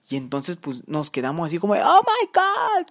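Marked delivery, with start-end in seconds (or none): 1.7-2.9 high, drawn-out hammed-up exclamation; mock shock. Teller and friends seeing the patrol cars, mock-shocked cry. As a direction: squeal it slowly in exaggerated disbelief.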